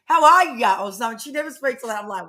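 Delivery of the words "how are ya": mock American "How are ya?", loud and high; mock American greeting. Retelling her sister's text in a hammed-up American accent. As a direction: ham up the Yankee "How are ya?". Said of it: "Sister wrote in the message".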